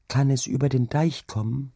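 put-on high, wondering little girl; child's voice. A narrator's reading. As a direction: ask anxiously as a small child. Child asks mother about the sea. (hohe, bange Kinderstimme)

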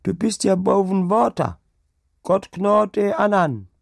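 put-on high desperate cries of a dying old woman, slowing at the end. Dying woman calling to the Deichgraf, high and desperate. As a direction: cry out for help as life fades.

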